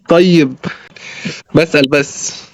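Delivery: normal talking voice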